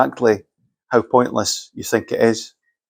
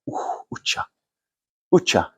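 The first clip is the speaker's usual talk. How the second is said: wince at the price; 3 semitones lower, 7 dB quieter than usual. Retold or imitated. Reaction to ten thousand pounds.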